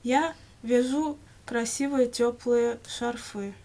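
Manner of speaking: usual speaking voice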